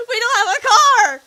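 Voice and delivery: very loud, high-pitched yell; imitating a guardian yelling back; exasperated shout. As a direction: shout the obvious at them. Guardian yells the obvious.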